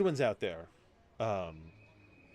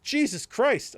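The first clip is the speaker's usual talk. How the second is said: exasperated relief; 12 semitones higher, 7 dB louder, much slower than usual. Retold or imitated. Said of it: "And I was like".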